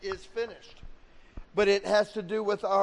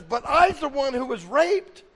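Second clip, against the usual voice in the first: acted girl's indignant objection, high and loud; loud protest; 6 semitones higher, 8 dB louder than usual. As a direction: protest loudly with raised pitch. Girl protests to God, acted.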